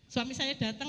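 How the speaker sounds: normal talking voice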